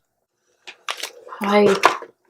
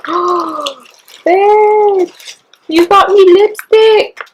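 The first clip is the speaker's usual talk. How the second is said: high delighted squeal; excited; 9 semitones higher, slower than usual. Real, not acted. Delighted at gift.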